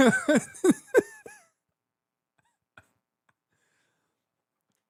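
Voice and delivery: giggling put-down; mocking with laughter; laughing mockery. Real, not acted. Mocking a speaker's outfit.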